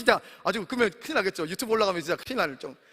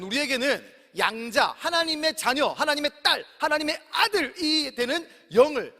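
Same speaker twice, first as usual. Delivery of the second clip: preaching build; 4 semitones higher, slower than usual. Acted. Preaching emphasis.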